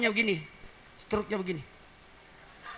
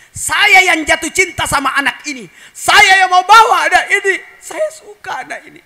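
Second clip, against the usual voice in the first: loud, high, impassioned begging; eager pleading; 10 semitones higher, 14 dB louder than usual. Acted. Halimah begging to take baby Muhammad home, loud impassioned plea. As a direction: plead as if you cannot leave without the child.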